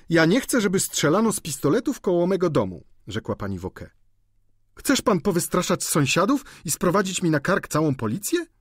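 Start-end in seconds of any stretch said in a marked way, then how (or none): 0.1-2.8 high, loud objection; indignant landlady. A narrator's reading. Landlady objects to shooting at house. 4.7-8.5 high, loud indignant question; scolding landlady. A narrator's reading.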